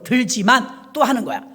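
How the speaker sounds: usual speaking voice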